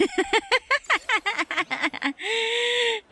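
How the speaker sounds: long, high-pitched giggle; gleeful high cackle; high delighted laugh. Real, not acted. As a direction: burst into a delighted, squealing laugh. Laughs at cute scene.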